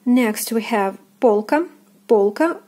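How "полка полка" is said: полка with full first "o"; careful model полка, weight on о; stressed O. Shown as a demonstration. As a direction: say полка as "POL-ka", rising. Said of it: "O is stressed".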